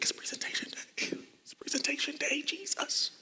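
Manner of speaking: panicked breathless whisper; nervous whisper. Retold or imitated. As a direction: whisper it to yourself in dread. Nervous thought before presenting.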